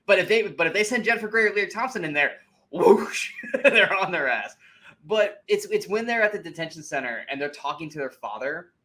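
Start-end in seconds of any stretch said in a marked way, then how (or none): 2.7-3.3 loud whooshing sound effect. Retold or imitated. Movie fan mimicking soldiers swooping in, whoosh. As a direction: make a quick, loud whoosh with the mouth.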